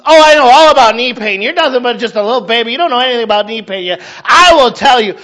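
high, loud, fast know-it-all: Oh I know all about knee pain; boastful one-upper. Acted. Sister Delberta topping the knee pain.

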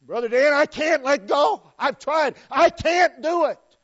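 acted loud, high outcry of helplessness; anguished protest. A churchgoer pleading with the pastor, loud anguished protest.

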